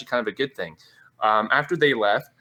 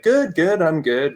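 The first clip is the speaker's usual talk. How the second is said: mock cheerful reply; 5 semitones higher, 5 dB louder, slower than usual. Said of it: "just saying, like".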